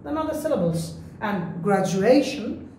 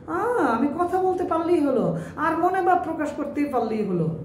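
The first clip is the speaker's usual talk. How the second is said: mock complacent; 6 semitones higher than usual. Mocking complacent Bangladeshi learners.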